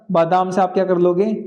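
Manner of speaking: usual speaking voice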